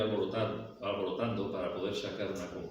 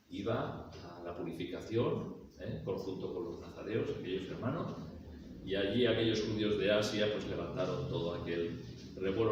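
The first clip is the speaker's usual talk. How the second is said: usual speaking voice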